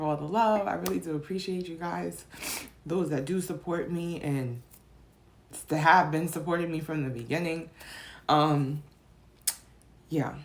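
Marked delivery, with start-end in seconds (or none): none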